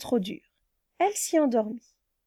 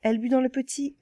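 ordinary delivery

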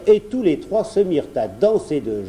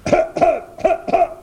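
high, acted dragon coughs; sharp repeated coughs as if spitting fire; dragon coughing fit; 5 dB louder than usual. A narrator's reading. Dragon coughs during the card game, acted coughing fit. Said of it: "le dragon toussait en crachant des flammes".

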